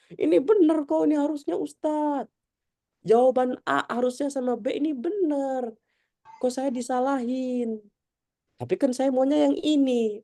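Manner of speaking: high, mock-indignant student voice; whiny protesting student. Teacher mimicking a student arguing over a wrong answer, high and whiny. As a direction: play the student who won't accept being marked wrong.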